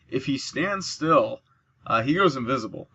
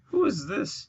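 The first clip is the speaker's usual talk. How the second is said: teasing voice; 9 semitones higher than usual. Pretend. Repeated mock question.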